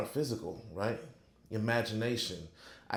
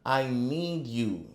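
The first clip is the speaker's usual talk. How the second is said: slow, drawn-out plea to the Lord; earnest, slow, louder prayer; pleading prayer; 3 dB louder, much slower than usual. Shown as a demonstration. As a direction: pray it like you mean every word.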